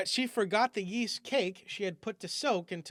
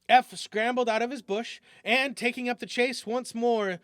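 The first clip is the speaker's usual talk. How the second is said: plain reading voice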